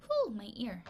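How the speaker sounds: ordinary talk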